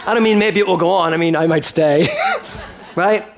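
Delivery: laughing through a joke about staying forever. Real, not acted. Minister joking with the congregation about never leaving, laughing.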